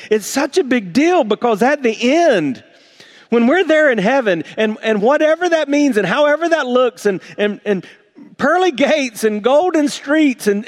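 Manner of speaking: excited build. Real, not acted. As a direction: lift the congregation with growing excitement. Preacher builds excited vision of heaven.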